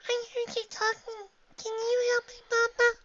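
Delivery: put-on very high, small child voice; pleading little filly. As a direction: beg for help like a child.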